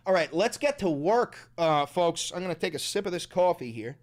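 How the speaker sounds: usual speaking voice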